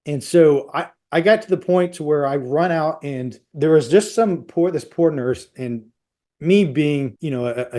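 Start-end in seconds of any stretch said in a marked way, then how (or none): none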